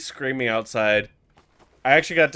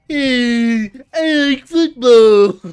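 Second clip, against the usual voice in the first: higher, louder, slow mock voice; mocking a slow kid; 10 semitones higher, 12 dB louder, much slower than usual. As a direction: drag out the words like a playground mockery. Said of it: "people making fun of".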